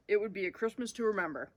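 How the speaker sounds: usual speaking voice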